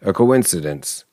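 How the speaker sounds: usual speaking voice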